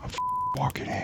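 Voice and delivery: put-on New York accent in a whisper. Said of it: "How does a New Yorker whisper".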